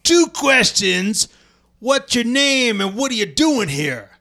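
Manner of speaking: imitated Southern challenge; put-on Southern accent. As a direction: quote the landowner in a drawn-out Southern accent. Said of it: "I'm about to give him a Southern accent".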